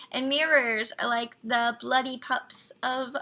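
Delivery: ordinary delivery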